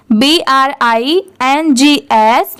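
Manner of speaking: ordinary talk, nothing marked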